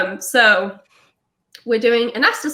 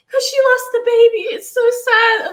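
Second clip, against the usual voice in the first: high-pitched mock sobbing explanation; tearful; 12 semitones higher, 5 dB louder than usual. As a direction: wail it like a retold crying fit. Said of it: "start burst into tears".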